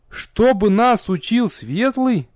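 high, slow, scornful question; incredulous sneer, pitched well up; contemptuous scoff. A narrator's reading. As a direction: draw it out in disdainful disbelief. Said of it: "презрительно фыркнул белобрысый любитель пил".

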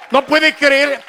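ordinary talk, nothing marked

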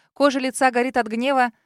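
usual speaking voice

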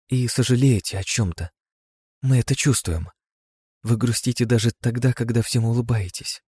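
put-on high, small child-like voice; a gentle little creature speaking; small girl voice. (тонкий детский голосок)

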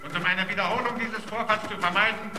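official announcement. Acted. Protection committee orders fighters to stop, official announcement. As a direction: read out an order to all sides, unmoved.

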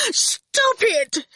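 high-pitched, gasped plea; pained gasp. A narrator's reading. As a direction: gasp it out against terrible pain. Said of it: "he gasped".

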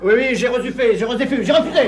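se justifier en hâte, voix haute et forte; défense paniquée. Acted. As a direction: protester pour se tirer d'affaire. Surpris par le mari, se défend. (affolé, sur la défensive)

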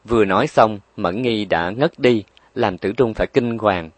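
ordinary delivery, nothing marked

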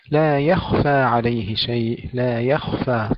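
slow يخفى with thin ي and full, still خ; light yaa, heavy khaa. Shown as a demonstration. Tajweed teacher modelling for students, measured يخفى with heavy خ. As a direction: recite يخفى with a heavy, vowelless خ. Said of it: "ترقيق الياء وتفخيم الخاء".

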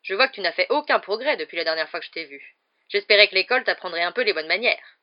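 loud, disapproving lecture; scolding aunt. A narrator's reading. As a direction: berate the boy for his manners. Aunt scolding her nephew.

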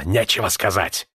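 usual speaking voice

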